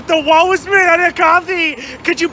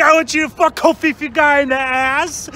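ordinary talk, nothing marked

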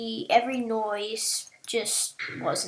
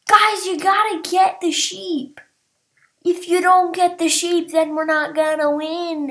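higher, louder bossy orders; loud scolding; 5 semitones higher, 6 dB louder than usual. Real, not acted. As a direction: tell your team off loudly. Scolding team.

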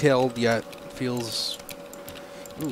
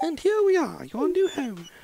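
high-pitched British character voice; British accent. Acted. Carpenter leads the newcomer to the farm, high British accent. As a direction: put on a much higher British voice. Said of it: "I don't know why I'm British".

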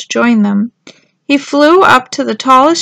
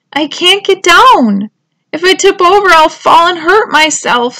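higher-pitched, anxious little turtle; worried; 6 semitones higher, 4 dB louder than usual. A narrator's reading. Stranded turtle fears tipping over, worried. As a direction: plead from a scary height.

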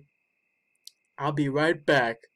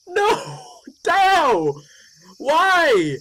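high, loud wail of grief; anguished cry; 9 semitones higher, 5 dB louder, much slower than usual. Real, not acted. Gamer wailing over the dead dog. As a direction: cry out as if heartbroken.

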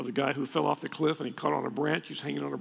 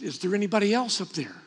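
louder, higher comic disbelief; punchline doubt; 4 semitones higher, 6 dB louder than usual. Retold or imitated. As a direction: toss it upward like a skeptical punchline. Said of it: "he looks up again and says".

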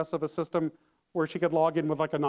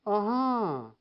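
drawn-out, falling "Uh-huh"; noncommittal uh-huh. Retold or imitated. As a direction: stretch "Uh-huh" downward without committing. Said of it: "notice I'm not saying words".

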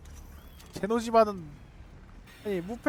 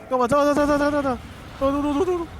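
panicked shouting; 6 semitones higher, 5 dB louder, much faster than usual. Real, not acted. Panic during attack.

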